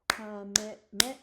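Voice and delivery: commitment stretched out slowly and loudly, one beat per syllable. Shown as a demonstration. Teacher counting syllables for the class, slowly broken-up word. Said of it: "We're gonna count them".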